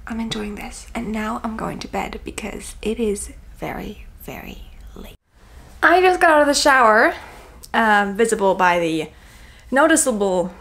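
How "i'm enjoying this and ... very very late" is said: breathy late-night whisper. Real, not acted. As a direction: whisper so nobody wakes. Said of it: "I have to whisper".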